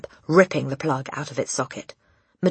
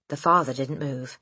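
usual speaking voice

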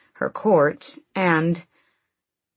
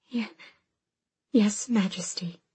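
stammering whisper; 4 semitones higher, 5 dB quieter than usual. Acted. Frightened girl answers queen.

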